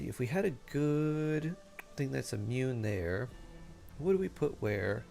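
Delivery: normal talking voice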